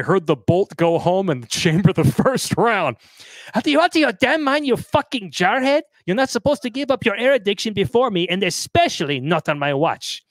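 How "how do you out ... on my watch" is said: furious wife. Retold or imitated. Wife chewing out her bleeding jarhead, furious and high. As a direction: tear into him like a drill sergeant.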